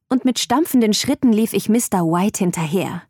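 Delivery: ordinary delivery, nothing marked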